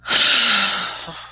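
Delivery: loud long breath out; deep sigh. Shown as a demonstration. Reader acts the deep breath for the children, loud sigh. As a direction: breathe it all out. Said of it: "She took a deep breath".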